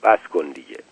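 low, restrained firm order; stern but understated; very serious. A narrator's reading. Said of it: "خیلی جدی رو به علی گفت".

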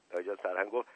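plain reading voice

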